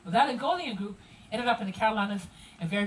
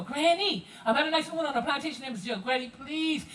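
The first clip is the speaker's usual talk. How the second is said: pitched-up, running Gullah chatter to Granny; Gullah dialect. Acted. Grandchild warning Granny about Miss Jill, Gullah dialect. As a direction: tell Granny about the guest in thick Gullah. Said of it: "this is how the conversation would go".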